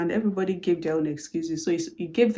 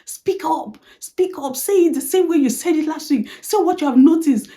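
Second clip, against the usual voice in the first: urging boss; 7 semitones higher, 4 dB louder than usual. Retold or imitated. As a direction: boss urging loudly, pitched up. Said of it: "She said".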